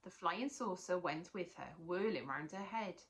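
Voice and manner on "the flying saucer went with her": plain reading voice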